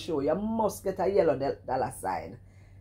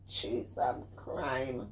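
slow exasperation; 3 semitones lower, 6 dB quieter, much slower than usual. Real, not acted. Slow exasperated sigh-phrase.

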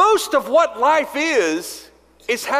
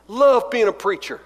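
usual speaking voice

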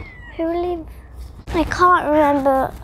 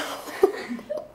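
giggling fit; 4 semitones higher, 8 dB quieter than usual. Real, not acted. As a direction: let the giggles burst out. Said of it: "can I just do my laughing first".

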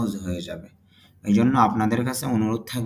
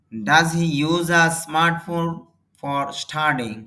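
slow model reading; much slower than usual. Shown as a demonstration. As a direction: dictate it word by word for learners. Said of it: "teacher models English sentence slowly". (ধীরে ধীরে জোরে পড়া)

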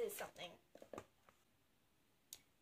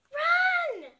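urgent yell; 14 semitones higher, 9 dB louder than usual. A narrator's reading. Urgent command in chase.

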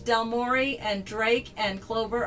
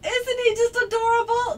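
cutesy voice; 12 semitones higher, 4 dB louder than usual. Real, not acted. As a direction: coo over something adorable. Gushing over cute drawing.

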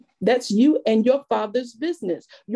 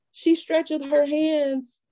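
slow scripture reading; 3 semitones higher, slower than usual. Shown as a demonstration. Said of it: "reads the verse slowly".

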